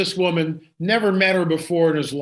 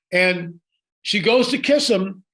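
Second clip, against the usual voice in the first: ordinary talk, nothing marked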